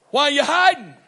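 high, loud question; loud high call; God calling out. Acted. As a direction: call out searching for him. Preacher voices God questioning.

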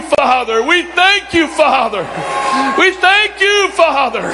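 call-and-response praise; fervent praise prayer. Acted. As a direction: lift praise to the Father.

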